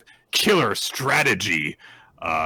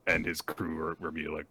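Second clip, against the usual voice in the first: usual speaking voice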